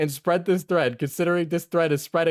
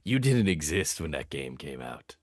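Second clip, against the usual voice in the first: mean taunting voice; 10 semitones lower, 6 dB quieter than usual. Pretend. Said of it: "now I'm just being mean".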